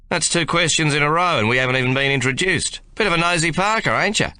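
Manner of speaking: a broad Australian soldier teasing; Australian drawl. A narrator's reading. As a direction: lean on the Australian accent to needle him. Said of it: "leaning on his Australian drawl".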